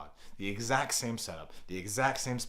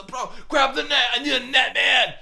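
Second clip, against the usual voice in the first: loud, high shout for help; hammed-up call for the net; excited urgency; 14 dB louder than usual. Acted fishing urgency for son.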